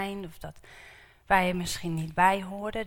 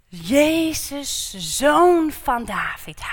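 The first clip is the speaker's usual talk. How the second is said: raised, high plea to Jesus, slowed on zoon van David; calling out; 5 semitones higher, 7 dB louder, much slower than usual. Acted. Beggar Bartimaeus calls to passing Jesus, raised pleading cry. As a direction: cry out to be heard over the crowd.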